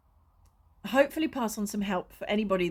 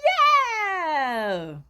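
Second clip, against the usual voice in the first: higher, louder whoop of triumph; mock cheer; 7 semitones higher, 6 dB louder than usual. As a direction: whoop like you just won. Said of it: "you go from this".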